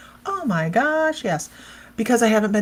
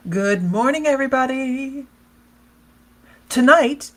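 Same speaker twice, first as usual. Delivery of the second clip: loud, high "Tonight"; excited announcement; 6 semitones higher, 5 dB louder than usual. Real, not acted. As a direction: call it out with excitement. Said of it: "excited announcement".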